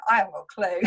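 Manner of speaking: a real laugh breaking through the line; laughing confession. Real, not acted. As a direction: own up with a laugh. Admits faking confidence, laughs.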